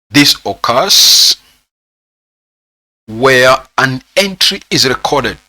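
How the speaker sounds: slow, drawn-out dictation pace. Real, not acted. Teacher dictating a definition for students to put down, slow dictation. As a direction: dictate it slowly for note-taking.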